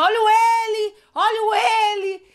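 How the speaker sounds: high, loud, stretched hawker's cry; imitated vendor's yell. Imitating friend openly selling at carnival, high loud yell. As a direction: belt out a mock street call. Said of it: "Só que ela gritava".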